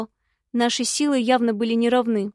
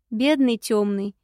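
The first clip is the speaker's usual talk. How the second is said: ordinary delivery, nothing marked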